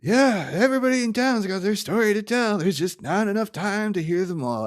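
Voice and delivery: lightly voiced character. A hint of a cartoon character wrapping up the film. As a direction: deliver the wistful closing line. Quoting character's closing line.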